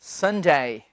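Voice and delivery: संजय as low, falling sun-DAAY; correct pronunciation. Shown as a demonstration. Teacher corrects students, careful long sun-daay for संजय. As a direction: stretch the ending of संजय into a day glide. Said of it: "ना कि".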